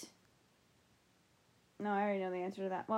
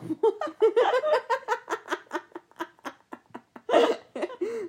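loud laugh; 12 semitones higher, 7 dB louder than usual. Real, not acted. Bursting into laughter.

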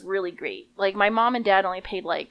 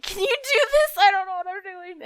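put-on much higher, helpless kid voice; panicked plea; 11 semitones higher than usual. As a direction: beg mom to fix it, squeaky and lost. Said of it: "I went crying to my mom".

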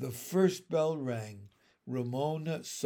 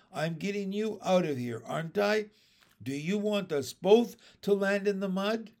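exasperated, higher-pitched scolding; ordering; 6 semitones higher, 4 dB louder than usual. A narrator's reading. Boy carrying girl through mud, scolding orders. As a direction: press each question on her impatiently. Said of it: "he ordered".

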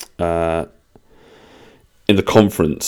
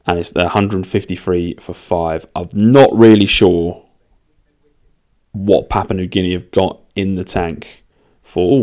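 ordinary talk

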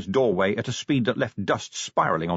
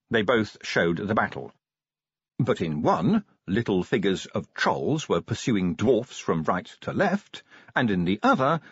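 plain reading voice

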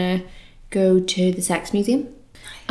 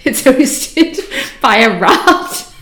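real, helpless laughter, high and loud; high-pitched, breaking up laughing; crying with laughter; 13 semitones higher, 7 dB louder, slower than usual. Real, not acted. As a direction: squeeze the words out through a laughing fit. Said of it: "I'm crying".

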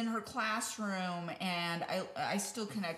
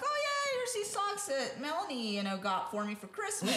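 breezy showing off; 7 semitones higher than usual. Retold or imitated. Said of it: "I'm like".

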